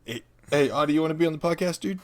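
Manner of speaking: high, fast pet-talk voice; talking to the cat. Real, not acted. Host talking to his cat in a high pet voice. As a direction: coo at the cat in a quick high voice.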